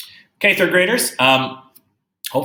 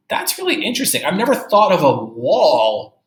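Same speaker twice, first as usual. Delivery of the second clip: much higher, marvelling; teacher lighting up at the line; amazed; 7 semitones higher, 5 dB louder than usual. Real, not acted. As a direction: gush that it fascinates you.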